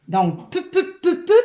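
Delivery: "peu" said in short repeated beats, the vowel made plain each time; sounding out peu. Shown as a demonstration. Teacher sounds out "peu" for a young reader, in short clear beats. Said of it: "essaie de lire les syllabes ici".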